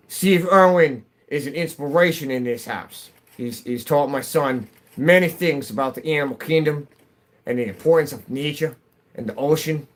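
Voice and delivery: put-on a light, fond Australian accent. Streamer praising Steve Irwin, light Australian accent. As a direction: talk like a proud Aussie parent. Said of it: "I can get into an Australian accent".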